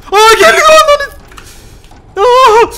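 high, loud frightened cry; panicked yelling. Real, not acted. Gamer seeing the monster coming, crying out high and loud. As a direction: yell as the thing comes at you.

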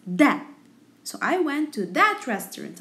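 ordinary talk

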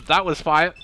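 ordinary talk

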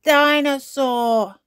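put-on high, loud, slow toddler shout; gleeful toddler. A narrator's reading. George adding to his sister's story, gleeful. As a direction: chime in with a favourite word. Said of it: "added George".

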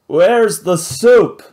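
loud, slow, rough pirate demand; drunk pirate. Acted. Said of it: "the pirate worded Vlad".

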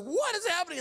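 high-pitched exclamation of disbelief; astonished crowd. Retold or imitated. Crowd watching the cleric dance, astonished. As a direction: blurt it high, imitating a baffled crowd.

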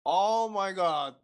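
rising shock, voice climbing high; real startled disbelief. Real, not acted. Shocked by anime plot twist.

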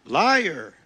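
loud furious cry of liar; shouted accusation. Acted. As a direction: hurl the word at the street preacher. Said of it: "he shouted".

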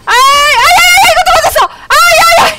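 high, loud cries of alarm; real high-pitched outburst; panicked yelling. Real, not acted. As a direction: yelp as the hits keep landing. Getting hit in the game.

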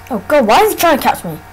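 ordinary talk

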